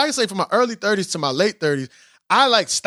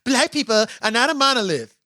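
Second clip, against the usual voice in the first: mocking quote. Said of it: "how he said the little pissant Negroes".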